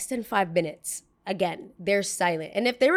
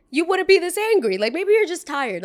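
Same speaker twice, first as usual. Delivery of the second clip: exasperated outburst; 9 semitones higher, 6 dB louder than usual. Real, not acted. Scolding the angry neighbor.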